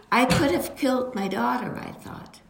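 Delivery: ordinary talk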